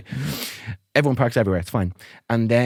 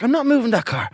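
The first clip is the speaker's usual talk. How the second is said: high, firm refusal pitched up; defiant refusal; 13 semitones higher, 3 dB louder than usual. Retold or imitated. Refusing the neighbour's order, high defiant refusal. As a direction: plant your feet and refuse.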